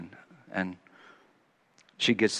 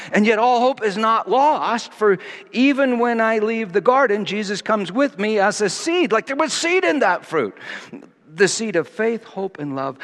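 loud, high, full-force preaching; a preacher building to a climax; fervent preaching; 11 semitones higher, 6 dB louder than usual. Acted. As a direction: preach it out with rising fervour. Preaching rises again.

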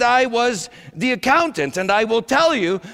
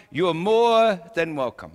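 mock humble reply; 5 semitones lower than usual. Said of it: "humbly reply".

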